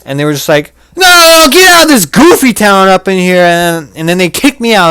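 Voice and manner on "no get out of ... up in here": high shouted outrage; hammed-up angry yell; mock shout. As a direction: yell them out of town. Retelling being kicked out.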